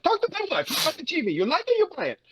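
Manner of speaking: high-pitched comic salesman; old SNL sketch voice. Retold or imitated. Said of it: "That voice from the old S N L sketch".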